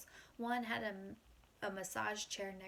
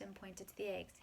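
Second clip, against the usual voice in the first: usual speaking voice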